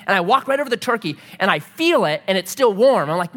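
normal talking voice

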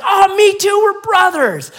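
high, shouted mock celebration; overjoyed rescuer. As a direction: shout it with hammed-up relief. Rescuer rejoices more.

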